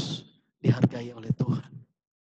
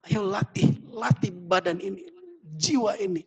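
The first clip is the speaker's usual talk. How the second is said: raised pitch, spurring them on; higher-pitched rousing appeal; urging exhortation; 6 semitones higher than usual. Acted. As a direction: urge it like a coach calling to train. Voicing the Spirit's urging.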